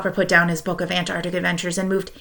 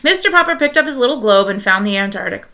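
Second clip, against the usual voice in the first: plain reading voice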